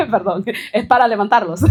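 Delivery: bursts of high laughter around a quick sorry; laughing apology. Real, not acted. Apologising to the listeners for the joke while laughing. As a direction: laugh through a fast apology.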